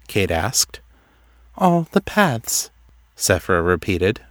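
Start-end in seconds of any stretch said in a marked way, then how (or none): 1.6-2.7 high, slow, detached repetition; dreamy seer. A narrator's reading. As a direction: drift slowly through it, pitched high. Seer repeats herself, dreamily.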